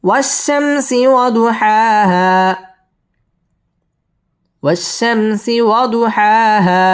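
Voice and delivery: slow, high with lengthened alif; slow model recitation. Shown as a demonstration. Teacher repeating the verse after tajweed rules, slow chant. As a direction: stretch the long vowels. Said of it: "এক আলিফ টেনে পড়তে হবে".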